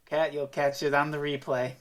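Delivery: usual speaking voice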